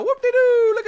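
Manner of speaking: high whooping cheer; gleeful whoop. Real, not acted. Celebrating game purchase.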